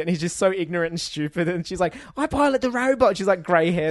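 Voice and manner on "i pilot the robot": high, loud imitation of a British old lady; Michael Caine-style accent. Tiny old lady telling the lead she pilots the robot, mock British accent. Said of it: "almost Michael, um, Caine accent".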